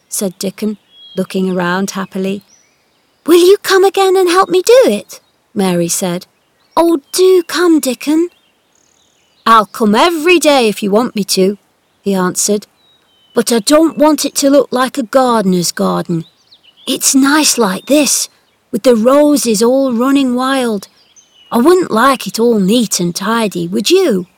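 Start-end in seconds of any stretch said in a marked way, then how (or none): 3.3-8.3 put-on higher, loud pleading girl; eager girl. 9.5-24.3 a hint of a cheerful boy; easygoing lad, gently louder; lightly voiced country boy. Dickon's friendly talk.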